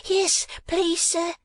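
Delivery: put-on high timid child voice; little boy. A narrator's reading. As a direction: answer timidly like a tiny boy. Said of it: "replied the little boy".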